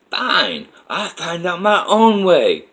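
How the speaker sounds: loud, high mock indignant yell; angry retort. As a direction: act out a stomping, defiant outburst. Stomping woman's angry retort is retold.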